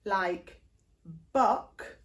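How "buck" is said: "buck" with a short southern uh; demo word buck. Shown as a demonstration. Voice teacher giving example "buck". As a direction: say "buck" with the posh southern vowel. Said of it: "if I put this in the context of a word".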